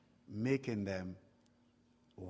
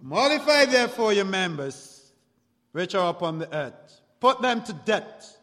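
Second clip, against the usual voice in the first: raised preacher's voice, pitched up; scripture declamation; 4 semitones higher, 7 dB louder than usual. Acted. Preacher reads a command to the church, declaiming. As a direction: proclaim the command from the pulpit.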